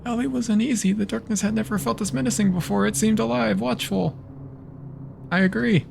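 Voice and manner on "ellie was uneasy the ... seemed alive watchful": lightly voiced, higher story reading; story narration. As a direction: read the game text like a tale. Reads the game's narration aloud.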